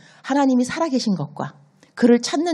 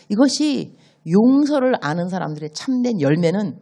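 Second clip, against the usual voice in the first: normal talking voice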